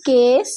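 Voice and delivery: কেস met een heldere e-klank, als in Bengaals এ; e sound demo. Shown as a demonstration. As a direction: zeg কেস met de klinker এ, niet ই. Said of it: "কয়ে একারের যে সাউন্ডটা হয় এ".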